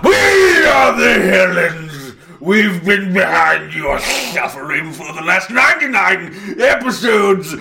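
villain shout. Acted. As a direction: boom it out like a cult leader. Villain grand reveal.

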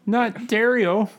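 high, slow silly voice. Pretend. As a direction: drawl it in a goofy voice. Mock voice correcting.

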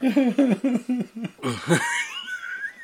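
big high whooping laugh. Real, not acted. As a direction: howl with laughter. Laughing at the accent joke.

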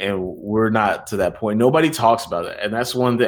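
ordinary talk

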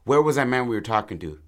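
loud, high, hurried question; panicked question. Acted. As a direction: demand it in rising alarm. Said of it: "get panicked. And she's like".

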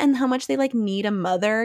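ordinary delivery

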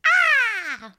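high, loud bird cry; twangy squawk like a bird; piercing bird call. Shown as a demonstration. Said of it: "bird sound".

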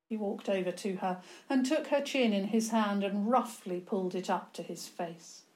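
ordinary delivery

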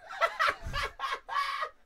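high, wheezy burst of real laughter. Real, not acted. Man telling about the funeral pictures, real high laughter. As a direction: crack up at your own story.

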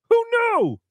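very high, held squeal falling at the end; hammed-up astonishment; sarcastic mock surprise. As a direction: feign total amazement.

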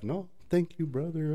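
a lightly voiced churchy brother; mock pious politeness. Imitating scammer's churchy politeness.

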